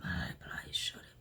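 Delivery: hushed pleading prayer; whispered prayer. Acted.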